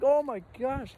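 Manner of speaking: high-pitched mock alarm; retold fright, pitched well up; scared of heights. Climber looking down a cliff, scared. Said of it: "I'm scared of heights".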